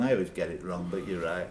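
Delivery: ordinary talk, nothing marked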